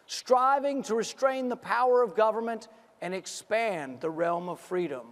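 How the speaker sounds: ordinary talk, nothing marked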